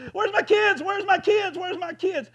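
high, loud hollering, fading on the last one; frantic parent hollering. Pretend. Parent lost in a crowded mall, hollering for kids. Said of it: "you're hollering".

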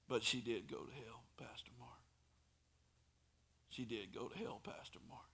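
hushed, breathless whisper. Retold or imitated. The daughter tells the pastor her mama went to hell, whispering. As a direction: let it fall to a whisper.

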